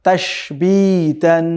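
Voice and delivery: syllables stretched out slowly; slow, deliberate model reading; slow joined reading. Shown as a demonstration. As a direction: draw the word out for the class. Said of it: "সব মিলিয়ে কি হলো?".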